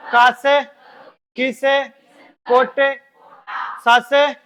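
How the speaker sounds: loud, rhythmic roll call of syllables; loud recital. Shown as a demonstration. Teacher leading class recital loudly syllable by syllable. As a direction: drill it loudly, beat by beat. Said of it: "देखो एक बार".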